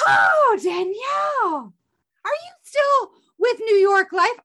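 squealing with joy at the caller; delighted phone greeting. Acted. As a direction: greet her like a long-lost friend on the phone. Acted phone call, delighted friend.

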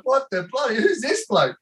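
high, incredulous mock outrage; baffled disbelief. Staring at a glass eye in his beer, incredulous. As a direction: pitch it way up, bewildered and laughing. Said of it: "I'm like".

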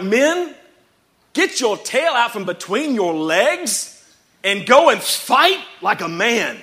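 put-on rally the men in a terrible Braveheart accent; bad Braveheart accent. Preacher imagining a battle speech to frightened men, hammed-up Braveheart accent. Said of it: "I know that was a terrible accent".

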